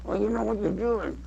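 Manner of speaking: high voice through closed lips; high puppet line, lips still; dummy voice. Shown as a demonstration. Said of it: "Use your voice through closed lips".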